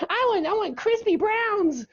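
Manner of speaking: whiny demand in mock falsetto; very high, squeaky imitation, insisting; Sophie. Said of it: "She's like".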